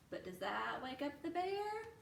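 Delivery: ordinary delivery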